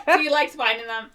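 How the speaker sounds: usual speaking voice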